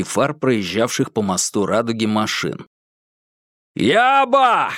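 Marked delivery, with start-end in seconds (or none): 3.7-4.7 high, sharp call over the water; shouting. Acted. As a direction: shout across the roar. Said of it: "крикнул Курц". (громкий окрик)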